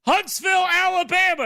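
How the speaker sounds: loud, high hammed-up wrestling announcer; wrestler promo voice. Wrestler pandering to a local crowd, hammed-up and loud. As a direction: ham it up like a wrestler working the crowd. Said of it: "he looks at his hand and goes".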